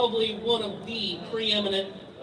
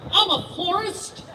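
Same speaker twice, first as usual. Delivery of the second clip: higher, slow and sweet, a put-on innocent voice; innocent florist; 9 semitones higher, slower than usual. Gun dealer to border cop, slow sweet innocent florist voice. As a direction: play it up as a harmless florist. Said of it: "I'm like".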